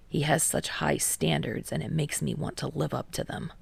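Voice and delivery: low, soft, reluctant confession; half-whispered grudging admission; lightly voiced Kei. A narrator's reading.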